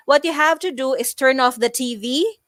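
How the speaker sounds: "T V" lifted like a question; statement turned question, rising on "T V"; rising inflection. Shown as a demonstration. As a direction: end the instruction on a questioning rise at "T V". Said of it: "using the rising inflection at the end".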